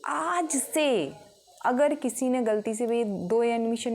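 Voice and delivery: high, very slow drawn-out teacher warning; stern teacher. Retold or imitated. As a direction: stretch the words out as a stern warning. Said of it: "ma'am कहती हैं".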